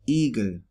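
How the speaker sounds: Igel with a long ee sound. Shown as a demonstration. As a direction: open Igel on a drawn-out ee. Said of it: "when it's long".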